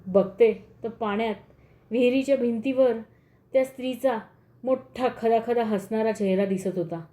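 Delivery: ordinary delivery, nothing marked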